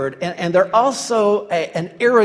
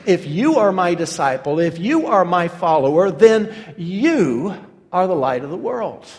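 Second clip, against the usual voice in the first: each "you" stressed, the last one held before a pause; piercing, emphatic YOU; 3 dB louder than usual. Retold or imitated. Jesus speaking to each disciple, pointed stress on "you". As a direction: build on every "you", then pause after the final "you". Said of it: "This is a piercing passage".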